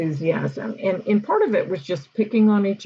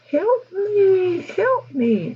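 very high, squeaky little mouse begging; tiny mouse plea; 13 semitones higher, much slower than usual. Pretend. Mice on prank Post-its pleading to the teacher, squeaky and high.

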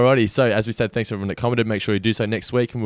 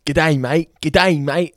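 exaggerated Aussie accent as English people fake it; English mimicking Australian; 4 semitones higher, 4 dB louder, much slower than usual. Cohost mocking English greeters, bad Australian accent. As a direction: overdo the Australian twang, pitched up. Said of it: "to England, everyone says".

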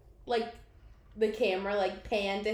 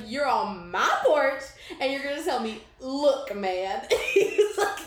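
mock Rufus; 3 semitones higher, 6 dB louder than usual. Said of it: "he was like".

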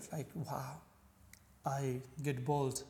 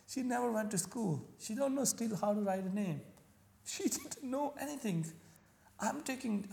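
higher, half-laughing disbelief at his mother; incredulous inner voice; 7 semitones higher than usual. Retold or imitated. Recalling doubting his mother, higher incredulous laugh-edged voice. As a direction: scoff that an unschooled woman could know better. Retelling his own scornful thought about mom.

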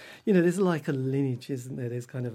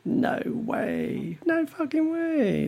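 much higher, slow, incredulous; mock disbelief; 14 semitones higher, much slower than usual. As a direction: sound like you can't believe it. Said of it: "they'd be like".

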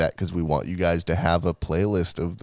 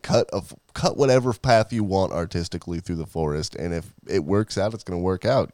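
normal talking voice

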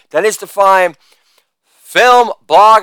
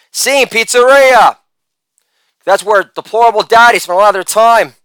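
usual speaking voice

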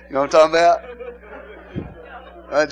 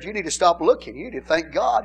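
ordinary talk, nothing marked

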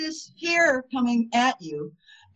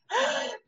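sharp excited gasp; 4 dB quieter than usual. Real, not acted. Excited about the book.

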